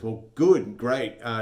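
loud, high, forced cheer; awkward loud cheer. Acted. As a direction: cover the awkwardness loudly. Instructor awkwardly covers up.

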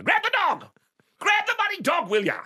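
very high-pitched mock yell; retold yelling. Irate father ordering son to grab dog, yelling. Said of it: "he's yelling at you".

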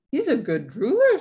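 high, loud, swooping praise; proud papa. A narrator's reading. Papa defends drooling Wolfie, proud and delighted. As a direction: praise the baby with gusto.

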